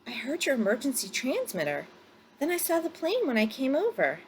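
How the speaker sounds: lightly voiced pilot, rising up at the end; a hint of a surprised pilot calling out; bush pilot. Pilot landing at the lake, lightly voiced surprise.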